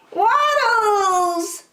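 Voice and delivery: high, drawn-out happy call; ducklings' high-pitched greeting cry; joyful cry. A narrator's reading. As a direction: call out to a long-missed friend, pitched high. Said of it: "they all cried".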